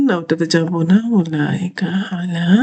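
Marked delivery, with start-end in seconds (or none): 1.2-2.5 whisper. Real, not acted. Reading Quran words under breath.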